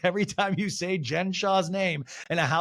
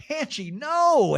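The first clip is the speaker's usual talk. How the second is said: high, long-drawn mock dismay; Heather impression; 12 semitones higher, 4 dB louder, much slower than usual. Castmate stopping her friend mid-fight, slow mock dismay. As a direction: stretch the plea out, pitched way up. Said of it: "And Heather goes".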